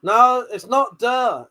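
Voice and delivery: slow, punched "not" with a mocking "duh"; "not" stressed, "duh" drawn out slowly. Shown as a demonstration. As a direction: lean on "not" and draw out "duh". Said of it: "It's D".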